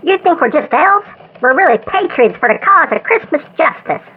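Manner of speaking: loud voice over a phone line. Acted. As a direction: shout your demands down a crackly phone. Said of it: "one of the trackers on the phone".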